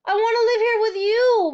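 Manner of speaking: very high, loud longing cry; cried out. A narrator's reading. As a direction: beg her teacher. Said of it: "Matilda cried out".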